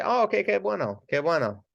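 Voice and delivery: high, bright "qué bueno" repeated; cheerful "qué bueno, qué bueno"; delighted reply. Shown as a demonstration. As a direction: sound pleased for the patient. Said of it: "And they can say".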